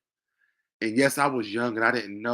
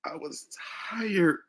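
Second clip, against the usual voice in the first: tearful; 10 semitones higher, 4 dB quieter than usual. Real, not acted. Tearful memory of being tired.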